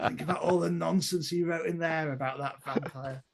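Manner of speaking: a hint of a bobby dismissing it all; constable gossiping. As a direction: play a copper picking over the find. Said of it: "shot of the constables going there".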